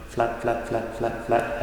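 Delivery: "flat" repeated evenly on one soft level note. Shown as a demonstration. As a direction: tap out each "flat" at the same pitch, quietly. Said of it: "it's just kind of".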